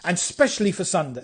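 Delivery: ordinary delivery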